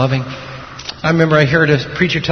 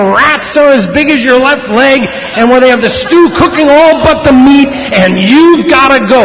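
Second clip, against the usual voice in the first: high, loud, hammed-up interrogation; demanding tyrant God; 11 semitones higher, 7 dB louder than usual. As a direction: play an overbearing God making demands. Mocked harsh God making demands.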